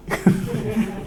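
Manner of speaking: breathless high giggle; high airy laugh. Real, not acted. Teacher laughing high and breathless at his own imitation.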